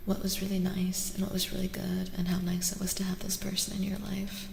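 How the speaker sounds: ordinary delivery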